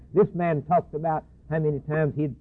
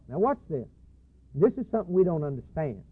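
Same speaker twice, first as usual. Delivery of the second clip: ordinary talk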